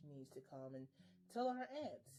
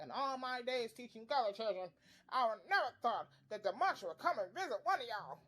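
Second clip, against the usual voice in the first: a bit higher and louder, mock old-school principal; mimicking the principal; 3 semitones higher, 5 dB louder than usual. Retelling the principal scolding Pearl, mock-indignant voice. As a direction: lightly play the scornful principal. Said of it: "and he's like".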